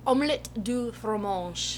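quiet cartoon French accent; a hint of Dexter's French phrase; softly mocking a cartoon French accent. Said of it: "teaching me how to do a French accent".

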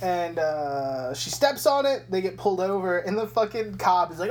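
ordinary delivery

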